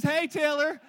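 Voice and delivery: a loud greeting shouted to Taylor; calling out loud. Acted. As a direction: call her name out big and bright. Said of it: "He says".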